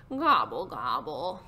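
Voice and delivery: turkey grumble dropping low; grumbled gobble. Acted. Turkey sulking after Pig saw through it, sinking grumble. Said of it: "grumbled Turkey".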